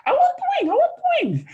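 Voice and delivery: put-on very high, loud childish whine; whiny demanding voice. Drunk friend grabbing at the turkey wing, imitated in a high whine. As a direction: beg for the wing like a greedy child.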